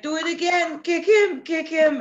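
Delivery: high excited cheering from a balcony; cheering bystander. Retold or imitated. Balcony neighbour cheering on the fight, high and excited. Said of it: "someone from the balcony was cheering".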